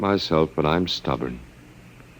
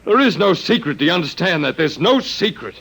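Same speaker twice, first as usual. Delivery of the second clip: desperate raised plea; 7 semitones higher than usual. Acted. Walter pleads with George to spare the woman, raised desperate voice. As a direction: push the pitch up and plead hard.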